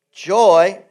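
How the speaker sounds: joy punched loud and high; stress on joy. Acted. Preacher reading Hebrews, booming on joy. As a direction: hit the word joy hard. Key word in scripture reading.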